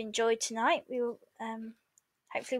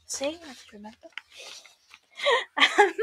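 usual speaking voice

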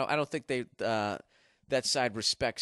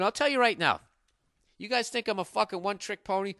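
ordinary talk

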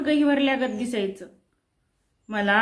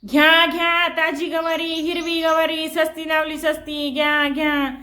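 all-out vendor shout; 3 semitones higher than usual. Acted. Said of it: "डोळं झाकून खच्चून ओरडायचो". (डोळे मिटून खच्चून भाजी विकण्याची आरोळी)

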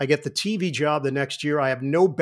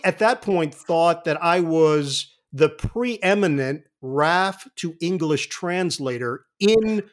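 ordinary talk, nothing marked

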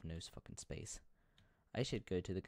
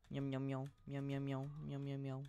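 nervous cat voice; 5 semitones higher than usual. Pretend. Meowing at the door.

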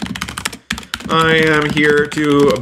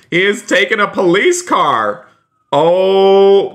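shocked exclamation; 6 semitones higher, slower than usual. Pretend. Streamer telling viewers about the stolen police car, shocked exclamation. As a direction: exclaim in playful shock, pitch climbing.

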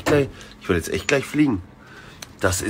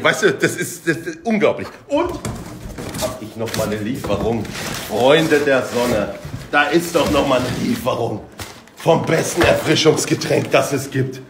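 usual speaking voice

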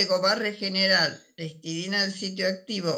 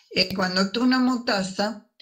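ordinary talk, nothing marked

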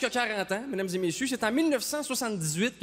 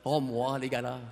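blasé gala guest; 11 semitones lower, 4 dB quieter than usual. Retold or imitated. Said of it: "Tout le monde ici a dit ça".

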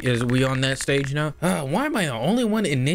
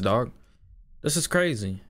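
ordinary talk, nothing marked

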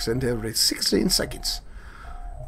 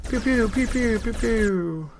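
mock laser sounds; 7 semitones higher, slower than usual. Imitating shots.

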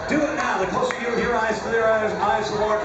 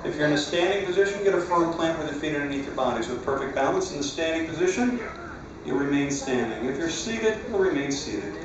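hypnotized dreamy voice; 5 semitones lower than usual. Acted. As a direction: lull them into deep sleep. Hypnotist putting people to sleep.

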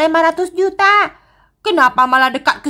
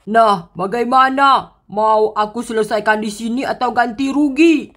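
deep looming brute searching; menacing giant; 6 semitones lower than usual. Acted. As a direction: growl like a giant hunting mice. Giant cornering the kids.

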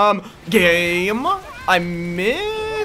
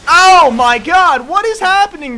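high, loud, slow drawn-out scream; startled scream; 8 semitones higher, 10 dB louder than usual. Real, not acted. Streamer suddenly jumped by Toga, startled scream. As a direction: let it rip as you're ambushed. Caught in chaotic fight.

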